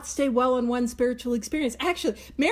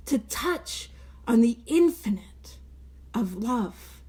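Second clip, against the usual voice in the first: whispered; slower than usual. Acted. Reverent moment in talk.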